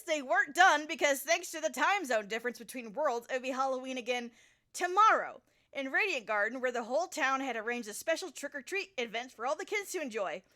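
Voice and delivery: ordinary delivery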